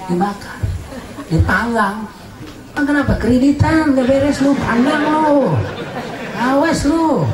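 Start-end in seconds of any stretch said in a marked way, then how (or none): 2.7-7.3 high teasing threat; a hammed-up scold, high and pushed; mock scolding. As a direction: wag a finger at the sinner, playfully.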